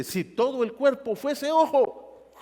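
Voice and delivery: booming Bible verse, slow; solemn scripture reading. Acted. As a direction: lift the verse up slowly like a proclamation. Pablo dice.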